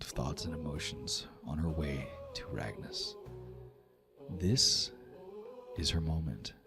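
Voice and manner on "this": ordinary talk, nothing marked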